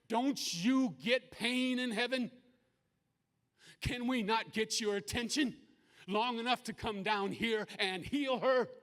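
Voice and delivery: high, loud plea to heaven; anguished demand. Retold or imitated. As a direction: cry out in protest, demanding help. Father pleads angrily with an angel.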